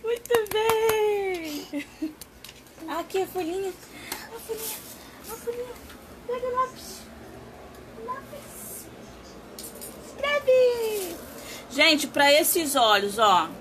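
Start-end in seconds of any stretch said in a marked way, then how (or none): 0.0-11.2 sing-song high coaxing, much higher and soft. Real, not acted. Talking to the parrot Tatá in a high coaxing voice. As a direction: coax it gently, up high like to a pet.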